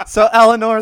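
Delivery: laughing through the name; giggling as you read. Real, not acted. Host laughing while reading question.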